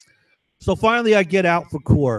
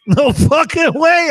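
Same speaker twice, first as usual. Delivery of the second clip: high, laughing repeat of "No fucking way"; delighted cackling disbelief; 13 semitones higher, 6 dB louder, slower than usual. Real, not acted. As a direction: burst out laughing as you say it in disbelief. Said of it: "laughing disbelief".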